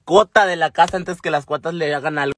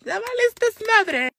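very high, squealed threat; re-enacted hysterical warning to a rat; frantic threat. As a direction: threaten it in a panicky falsetto. Threatening the rat, stick in hand.

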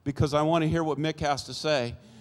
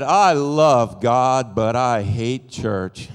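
loud, drawn-out, emphatic statement; slow retold teenage defiance, starting loud; defiant declaration; 5 semitones lower, 6 dB louder, much slower than usual. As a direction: stretch it out slowly and defiantly.

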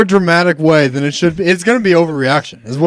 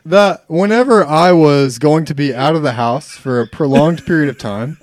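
usual speaking voice

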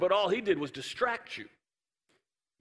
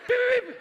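piercingly high, louder beeps; treadmill beeps; 5 dB louder, much faster than usual. Retold or imitated. Storyteller mimicking her speeding up the treadmill, loud high beeping. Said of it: "she keeps, like".

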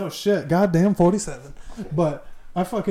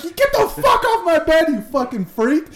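high mock-angry shout; acted outrage; 9 semitones higher than usual. Storyteller throwing bunkmate off his bed, outraged. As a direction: yell it as comic disgust. Acts out kicking friend off bed.

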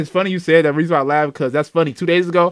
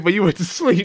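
imitating friend. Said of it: "he said".

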